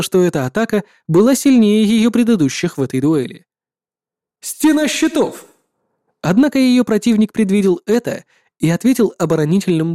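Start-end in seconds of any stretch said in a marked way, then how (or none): 4.4-5.4 high, slow war cry; shouted battle cry. Acted. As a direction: call the shield wall out. Battle cry of shield skill.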